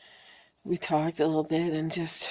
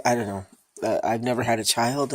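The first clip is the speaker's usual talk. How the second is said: normal talking voice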